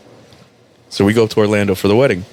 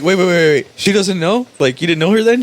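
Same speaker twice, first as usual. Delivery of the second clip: mock disbelief; 8 semitones higher than usual. Retold disbelief about the wife.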